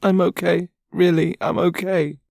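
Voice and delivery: high, tearful voice fighting back sobs; high-pitched crying reassurance; through sobs. Acted. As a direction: sob the words out, trying to sound fine. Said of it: "speaks through her sobs".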